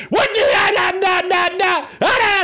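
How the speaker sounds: hammed-up shouted gibberish in place of swearing; mad cursing. Preacher imitating mad religious leaders cursing, high mock rant. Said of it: "them jokers got mad".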